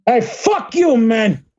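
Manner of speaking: high-pitched fake insult; high mock tough-guy shout. Playful insult at sign-off.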